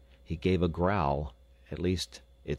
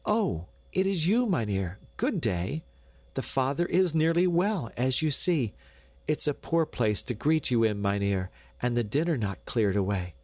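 high, flustered hostess voice; flustered Dame Brinker; 6 semitones higher than usual. A narrator's reading. Dame greeting the doctor, flustered and high. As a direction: greet the visitor high and apologetic. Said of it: "stammered Dame Brinker, hastily trying to hide".

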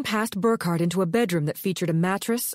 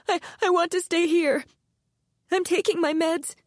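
much higher, panicked pleading; frightened whimpering; 12 semitones higher than usual. Acted. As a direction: beg in terror, voice pitched up. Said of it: "began to whimper".

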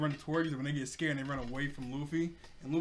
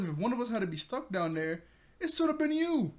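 mock retort; 8 semitones higher, 4 dB louder than usual. Zoro snaps back at Nami.

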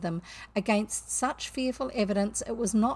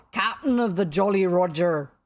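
mimicking Hook; 2 semitones lower, 7 dB louder than usual. A narrator's reading. Boy claims to be the captain, mimicking. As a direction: announce yourself as the captain, booming. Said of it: "in Hook's voice".

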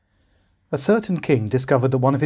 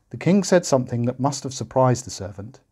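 plain reading voice

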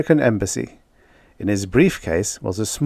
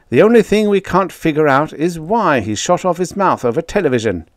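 higher, louder and fast at first; excited secretary; 7 semitones higher, 4 dB louder than usual. Acted. Secretary of defense briefing the president, excited. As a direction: rattle off the FBI findings, amazed.

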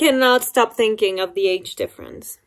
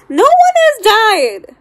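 much higher, louder and slow; exasperated cry; 11 semitones higher, 5 dB louder, slower than usual. Real, not acted. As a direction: cry it out in disbelief. Frustrated exclamation while reading.

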